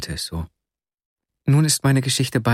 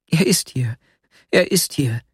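higher, urgent insistence; raised, agitated repetition; excited interruption; 5 semitones higher than usual. A narrator's reading. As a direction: cut in, too worked up to wait. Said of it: "fiel mir Nastjenka erregt ins Wort".

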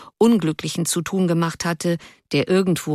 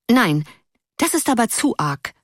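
higher-pitched prim protest; indignant outburst; 4 semitones higher than usual. A narrator's reading. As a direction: burst out in mild indignation. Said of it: "brach eine von den Sitzsamen auf dem Straßendamm los". (empört losbrechend)

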